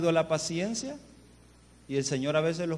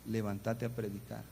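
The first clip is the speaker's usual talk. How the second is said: low, soft voice from within; hushed, low-pitched command; inner voice; 5 semitones lower, 8 dB quieter than usual. Retold or imitated. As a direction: deliver a quiet, low inner summons. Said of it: "de repente volví a sentir".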